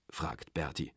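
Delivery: usual speaking voice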